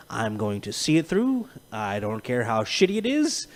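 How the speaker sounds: low, soft, stoic vow; mock-solemn determination, kept low; grim resolve. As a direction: declare it quietly and doggedly. Voicing stubborn see-it-through mindset.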